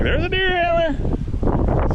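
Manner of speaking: high, slow squeaky mock excitement; high excited squeal. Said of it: "and I'm like".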